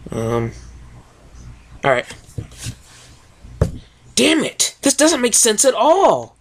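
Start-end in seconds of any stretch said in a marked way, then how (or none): 4.1-6.4 higher, frustrated tomboy pony voice; a lightly voiced hiss of frustration; frustrated Rainbow Dash. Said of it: "hissed Rainbow Dash".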